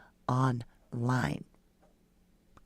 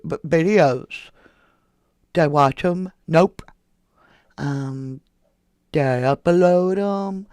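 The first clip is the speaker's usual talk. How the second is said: mock witness; 2 semitones higher, 8 dB louder than usual. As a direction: play the bumbling witness dodging questions. Mocking Mowry on the stand.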